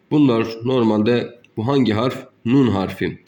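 ordinary talk

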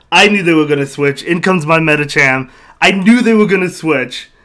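loud, gleeful I-called-it exclamation; excited triumph. Real, not acted. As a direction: burst out, vindicated by a correct prediction. Correct call during live battle.

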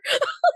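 laughing squeal; high squealing laugh. Real, not acted. Big laugh after joke.

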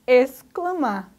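exclamar said slowly, x and c both sounded; slow teaching exclamar; careful pronunciation. Shown as a demonstration. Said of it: "Pronunciamos tanto X quanto C".

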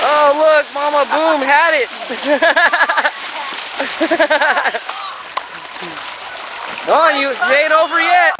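root for them at the top of your voice; excited cheering. Real, not acted. Cheering on a race.

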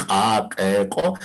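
"A, e, o" each with an ejective k onset; glottalized series. Shown as a demonstration. Teacher drills the glottalized sounds, "A, e, o" with popped k. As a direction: pop a k before each of "A, e, o". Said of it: "Vamos a decir eso".

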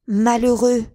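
Sarah turning on Daniel; bitter reproach. Acted. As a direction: lash out at him. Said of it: "fit-elle".

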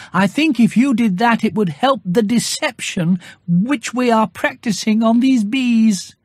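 high, earnest little bear asking a favour; a bear plotting to fool the bees; Pooh Bear voice. A narrator's reading.

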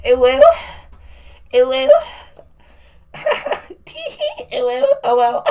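very high squeaky voice with giggles; high mock-girly tee-hee; silly squeaky giggle. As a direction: squeal like a cartoon kid.

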